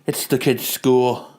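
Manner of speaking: a weary wife handling everything. Acted. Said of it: "his wife starts talking on the phone".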